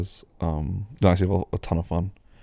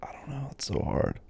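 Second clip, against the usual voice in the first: whisper; 6 semitones higher, 7 dB quieter than usual. Real, not acted. Stuck choosing three words.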